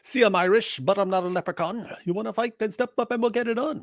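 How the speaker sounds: high put-on Irish brogue, tough-guy bluster; cartoon Irish leprechaun picking a fight; mock Irish accent. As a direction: ham up a scrappy Irish challenge. Mocking Irish commenter in voice.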